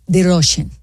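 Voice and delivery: put-on Russian accent on "Russian"; thick comic Russian accent. Shown as a demonstration. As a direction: say "Russian" with a rounded Russian-accented first vowel. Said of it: "the only way to go into the Russian".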